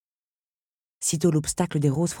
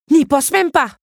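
avertissement ferme, plus haut et fort; mise en garde; 11 semitones higher, 10 dB louder than usual. A narrator's reading. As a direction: menace le crapaud d'une voix forte. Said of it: "le mit en garde Belle". (Belle met en garde le crapaud, ton menaçant)

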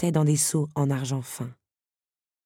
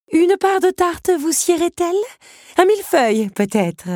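very high, loud hostess, easing down at the end; fussing countess; 14 semitones higher, 7 dB louder than usual. Acted. Embarrassed countess offering Belle dessert, fussily polite. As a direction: coax the guest with over-sweet offers.

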